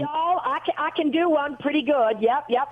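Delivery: demonstrating a Southern US accent; Southern drawl. Shown as a demonstration. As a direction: show off a playful Southern drawl. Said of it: "you should have a Southern draw".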